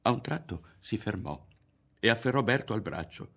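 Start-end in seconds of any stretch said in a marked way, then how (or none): none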